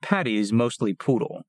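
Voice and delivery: usual speaking voice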